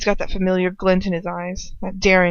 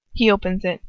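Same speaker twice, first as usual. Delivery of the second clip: usual speaking voice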